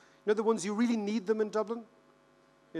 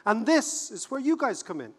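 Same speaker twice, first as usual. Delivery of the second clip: normal talking voice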